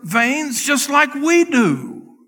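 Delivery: high, loud and slow preaching peak; impassioned preaching. Acted. Preacher stressing Mary's humanity, high and slow. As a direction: lift it high and slow it down, stretching each word.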